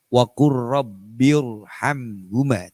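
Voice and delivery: rabbirhamhuma slow with a thick R; heavy R. Shown as a demonstration. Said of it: "tetap dibaca tebal".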